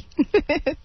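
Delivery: short high giggle; a quick amused laugh. Real, not acted. Laughs at name coincidence.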